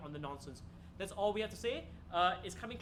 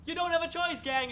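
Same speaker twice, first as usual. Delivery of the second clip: mock threat; 8 semitones higher, 8 dB louder than usual. Teasing audience into hearing jokes.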